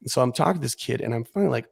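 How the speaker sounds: usual speaking voice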